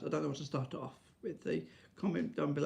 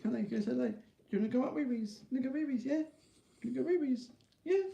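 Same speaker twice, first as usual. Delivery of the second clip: very high, sing-song pet voice; baby talk to dog; 9 semitones higher, faster than usual. Pretend. Talking to his Jack Russell, squeaky baby talk. Coaxing the dogs.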